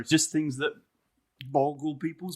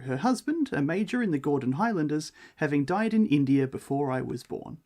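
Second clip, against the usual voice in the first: measured storybook reading voice; story narration; 3 dB louder than usual. Acted. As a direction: read it like an old ghost tale, unhurried. Reading the ghost story aloud.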